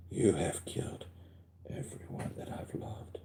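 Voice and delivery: soft, unvoiced, seething whisper; angry whisper. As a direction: hiss it under your breath. Repeating Scarlet Witch line.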